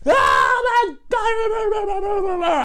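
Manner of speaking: very slow, high, loud wail; child shrieking at steaming feet; horrified shout. Retold or imitated. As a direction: stretch it out in pure horror. Seeing steam from his feet.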